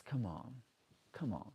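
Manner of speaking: ordinary talk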